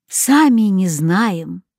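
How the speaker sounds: usual speaking voice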